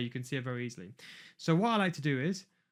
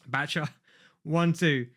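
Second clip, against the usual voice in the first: normal talking voice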